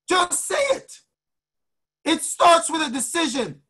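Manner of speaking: high-pitched, fervent exhortation; motivational preaching. Real, not acted. Coach urging listeners to speak freedom into existence, fervent preaching. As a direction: preach it in punched, repeating phrases.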